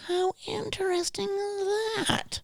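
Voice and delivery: tiny, very high, drawn-out squeak; slow, soft falsetto, feigning fascination; mock wonder. Patient reacting to suddenly found medicine, mock wonder.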